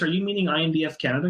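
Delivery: ordinary talk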